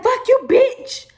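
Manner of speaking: hammed-up furious insult; mock yell. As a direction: bark it like the rude guy did. Retelling a man cursing her.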